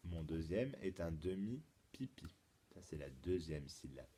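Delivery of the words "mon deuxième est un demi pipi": lower, softer restatement of the clue; subdued repeat. Shown as a demonstration. Teacher repeats the clue for pupils, low and soft. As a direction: repeat it quietly for them to think over.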